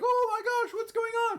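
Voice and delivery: very high, squealed alarm; hammed-up high-pitched freak-out; mock panic. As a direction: play kids squealing in panic. Acting the panicked kids.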